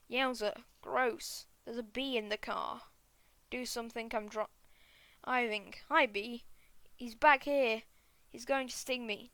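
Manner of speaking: usual speaking voice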